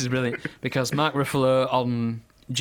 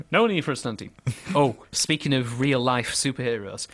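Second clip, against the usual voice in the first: ordinary talk, nothing marked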